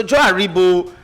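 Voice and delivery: ordinary talk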